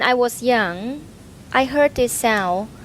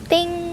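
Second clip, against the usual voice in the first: high ringing ding; metal ding; 10 semitones higher than usual. Shown as a demonstration. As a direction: imitate a struck metal bell. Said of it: "It sound like you hit the metal".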